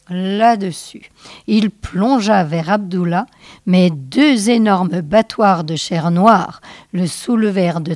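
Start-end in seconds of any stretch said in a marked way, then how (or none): none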